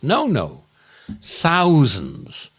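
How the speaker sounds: ordinary delivery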